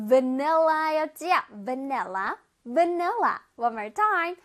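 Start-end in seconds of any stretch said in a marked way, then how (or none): 1.7-3.4 바닐라 as English vanilla, vuh-NEL-la; proper English pronunciation. Shown as a demonstration. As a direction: stretch and stress the middle of 바닐라, starting on v. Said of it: "제대로 발음하면".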